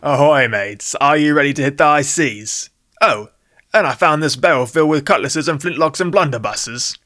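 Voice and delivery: a light, hammed-up pirate brogue; ridiculous pirate accent. Jack greets his friends in a lightly put-on pirate accent. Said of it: "talking in a ridiculous accent".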